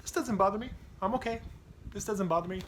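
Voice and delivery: strained high insistence; forced calm rising. Retold or imitated. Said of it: "I tend to suppress my feelings, like".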